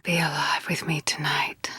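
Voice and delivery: intimate whispered invitation. Acted. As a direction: breathe it close to her ear.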